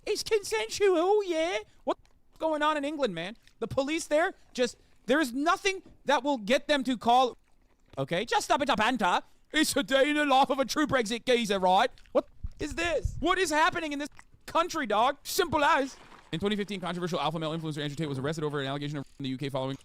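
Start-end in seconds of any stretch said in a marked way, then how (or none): 0.1-1.6 high, hammed-up Cockney geezer accent; slow mock London accent, much higher; mock British cop. Streamer mocks British police in Cockney accent. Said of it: "the British authorities be like". 1.8-16.0 mock British accent. Keeps mocking British police.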